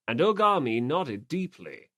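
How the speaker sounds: plain reading voice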